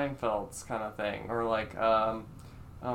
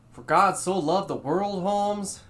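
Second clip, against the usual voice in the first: higher, louder comedian impression, playing God; George Lopez; 6 semitones higher, 7 dB louder than usual. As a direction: recite scripture as a comic's impression, pitched up and loud. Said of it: "George Lopez, if he were God".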